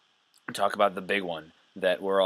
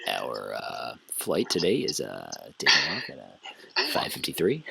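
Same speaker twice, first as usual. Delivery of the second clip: low, soft pilot on the cabin intercom; airline pilot announcement; 6 semitones lower, 5 dB quieter than usual. Pretend. Teasing co-host as pilot addressing passengers, low mock announcement. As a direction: mumble it deep like a bored-sounding captain. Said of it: "telling someone to buckle up for their flight".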